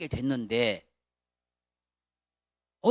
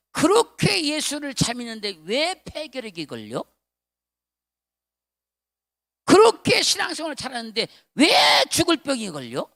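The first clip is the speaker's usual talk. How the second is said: mocking critics; 6 semitones higher, 8 dB louder than usual. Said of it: "그 사람들이 뭐라고".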